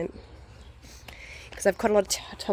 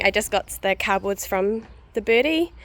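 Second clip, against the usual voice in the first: usual speaking voice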